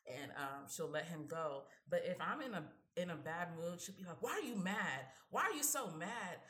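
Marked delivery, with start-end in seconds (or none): 4.2-6.4 pitched-up pestering question, imitating mother; mimicked nagging mom. Mom pestering her moody daughter, imitated nagging. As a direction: needle her with mock insistence.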